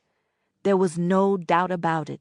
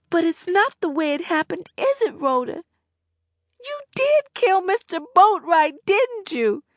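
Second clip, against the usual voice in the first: much higher, louder; pressing girl; 12 semitones higher, 4 dB louder than usual. A narrator's reading. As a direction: demand a confession. Annette presses Rhoda.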